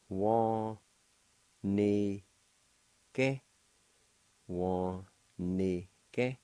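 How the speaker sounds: teacher sounding out "Wa-ni-ke" carefully; Cherokee pronunciation demo. Shown as a demonstration. Teacher models Cherokee word for delicate, syllable by syllable. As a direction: say "Wa-ni-ke" as wa-ni-ke, each syllable clear. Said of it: "it's pronounced like this".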